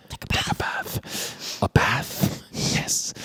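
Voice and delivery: retold in a whisper; barely audible acted murmur; hushed exchange. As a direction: whisper it back and forth, very softly. Retold exchange between Robin and Marion.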